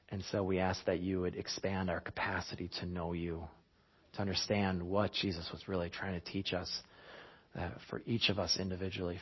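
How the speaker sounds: soft, low opening prayer. Real, not acted. Pastor praying over the congregation, soft and reverent. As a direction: pray quietly before the sermon.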